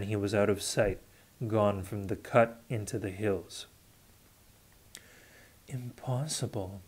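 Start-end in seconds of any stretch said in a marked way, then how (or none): 5.7-6.8 faint murmur; barely voiced, to himself. A narrator's reading. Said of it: "Covenant murmured".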